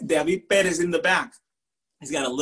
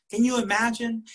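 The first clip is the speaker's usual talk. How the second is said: ordinary delivery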